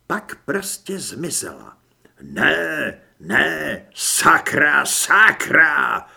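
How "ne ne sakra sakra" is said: loud, frustrated cursing; furious outburst, building louder; angry yell. A narrator's reading. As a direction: yell each word in anger. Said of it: "Zaječel jsem naštvaně".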